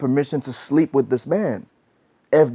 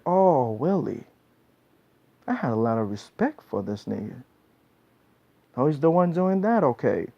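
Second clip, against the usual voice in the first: quiet disappointment; 4 semitones lower, 6 dB quieter than usual. Acted. Woman's disappointed reaction to Willie.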